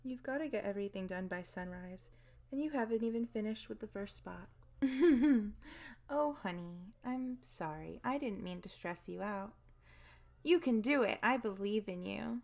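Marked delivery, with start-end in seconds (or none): 4.5-6.0 loud teasing laugh; gleeful laugh. Acted. 10.4-12.4 mock cheerleading. Fake encouragement.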